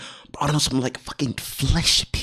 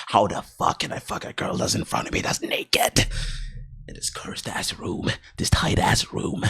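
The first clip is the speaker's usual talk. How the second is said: dejected whisper; 6 semitones lower, 6 dB quieter than usual. Real, not acted. Confessing low self-confidence.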